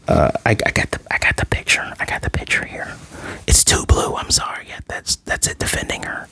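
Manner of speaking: soft unvoiced whisper aside. Real, not acted. Checking a picture mid-recap for viewers, whispered.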